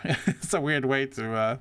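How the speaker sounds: ordinary delivery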